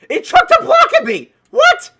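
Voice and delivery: high, loud startled cry; shocked shout. Real, not acted. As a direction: yelp in disbelief at the golem. Golem throws block at him.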